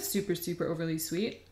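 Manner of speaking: talking while chewing; speaking around a bite of cracker; mouth full. Real, not acted. Seen 0: holds snack at lips, bites at 1.6, hand at mouth after.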